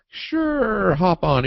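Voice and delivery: high, breezy sure, hop on; naive sure. Retold or imitated. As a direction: say it like a happy pushover.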